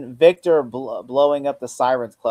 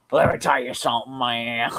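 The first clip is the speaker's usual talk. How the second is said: fake Southern accent. Mocking a villain's suddenly Southern speech, fake Southern accent. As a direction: imitate a badly faked Southern accent. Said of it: "he slips into a Southern accent".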